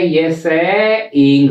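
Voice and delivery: सेइंग said slowly, stressed; drawn-out सेइंग; slow model word. Shown as a demonstration. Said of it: "ये सेइंग है". (सेइंग धीरे और ज़ोर से)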